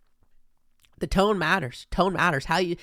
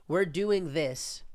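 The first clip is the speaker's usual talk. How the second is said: slow mock reassurance; 4 dB quieter, much slower than usual. Mocking the press secretary's reply, very slow. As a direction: draw it out very slowly, mocking. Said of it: "your tone of how you said things".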